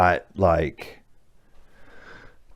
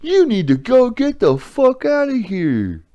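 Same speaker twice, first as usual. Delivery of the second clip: high, put-on voice of someone taking his side; mock a supporter cheering him on; imagined backer egging on. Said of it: "and be like".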